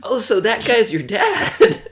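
slow, laughing pretend question; mock confused question. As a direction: play dumb through a laugh. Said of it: "And I'm like".

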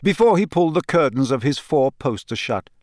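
plain reading voice

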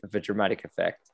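normal talking voice

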